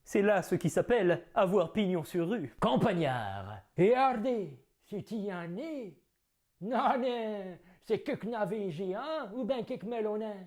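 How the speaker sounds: theatrical Cyrano declaiming; grand stage verse delivery; hammy stage actor. Acted. Performs Cyrano's nose tirade.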